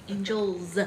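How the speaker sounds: "Angels" said alone, its final s buzzed out; stress on the plural in "Angels". Real, not acted. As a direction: draw out the plural ending of "Angels". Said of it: "It was a plural".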